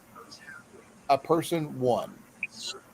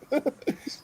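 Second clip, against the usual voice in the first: high laugh; 12 semitones higher, 5 dB quieter than usual. Real, not acted. Amused reaction.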